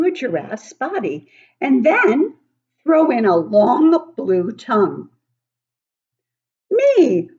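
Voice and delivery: put-on storybook okapi teasing the children; baby okapi voice. Okapi riddle from the book.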